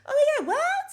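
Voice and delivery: very high, loud squeaky shock; shrieking mock what; mocking shocked Giselle. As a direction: play her caught completely off guard. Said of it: "you know Giselle, how she be looking".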